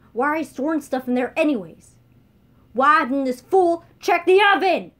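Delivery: furious rant. Acted. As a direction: rage at the fool. Chancellor rages.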